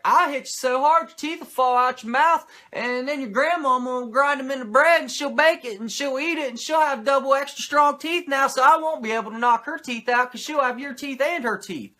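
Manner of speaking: high, loud absurd threat; Mongo impression. Mongo threatening an opponent, loud and high. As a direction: yell the silly threat like a pumped wrestler. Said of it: "where he's like".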